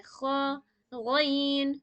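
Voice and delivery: خا and غین said heavy with the mouth full; full-mouthed letters. Shown as a demonstration. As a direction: give خا a rasp like clearing the throat. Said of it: "منہ بھر کے پڑھیں گے".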